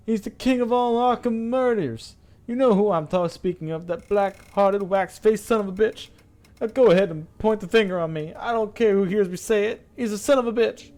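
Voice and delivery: high, loud, impassioned accusation; worked-up, high-pitched denunciation; distraught man behind a door. Acted. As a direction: rant at full tilt in a high character voice. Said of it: "sobbing breath before continuing".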